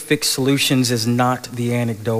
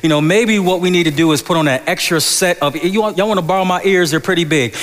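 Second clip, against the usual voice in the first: ordinary delivery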